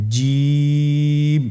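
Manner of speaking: জিম held for three alif, the vowel stretched; drawn-out Jim. Shown as a demonstration. Teacher showing the class how to pronounce জিম. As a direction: demo জিম slowly and clearly. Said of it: "বলুন শুরু থেকেই".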